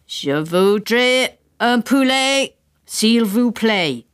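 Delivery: slow careful English French; English-accented French. A narrator's reading. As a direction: spell it out in school French. Father asks in French.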